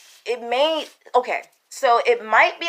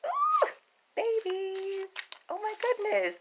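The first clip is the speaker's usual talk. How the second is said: very high, softer cooing over babies; excited squeal; 13 semitones higher, 7 dB quieter, slower than usual. Real, not acted. Spotting babies in the Pisces reading, high cooing.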